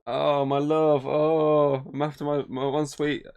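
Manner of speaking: high, slow hammed-up lovesick sigh; mock romance lines. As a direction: ham up a cheesy romantic lead, drawn out. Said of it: "It's not the idea of".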